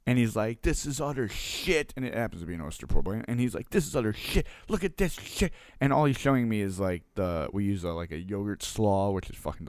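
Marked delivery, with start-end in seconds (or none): 0.6-1.9 imitating a fired-up customer, higher and slow; mock-angry complaint, drawn out and pitched up; mad customer. Angry customer showing owner his oyster po' boy, mock mad. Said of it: "he's mad, he's fired up". 3.7-5.5 imitating an irate customer, pitch climbing high; mock outrage, jumping higher on the second sentence; mad customer. Customer thrusting his plate at the owner, mock mad. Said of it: "he's mad, he's fired up".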